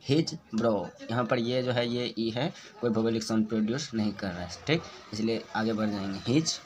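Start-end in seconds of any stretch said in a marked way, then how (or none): none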